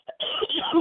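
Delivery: single dry cough. Real, not acted. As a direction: clear the throat with a cough. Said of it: "excuse me, again".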